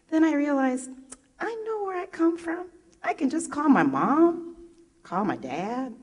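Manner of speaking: high, bright burst of realization; excited realization. Real, not acted. Speaker to audience, realizing her family holds the stories: excited. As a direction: it suddenly dawns on her, lifted high.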